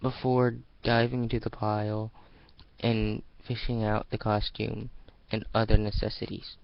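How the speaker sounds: usual speaking voice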